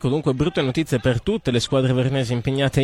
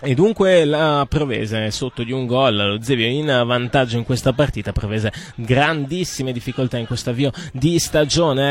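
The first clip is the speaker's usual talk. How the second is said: ordinary talk, nothing marked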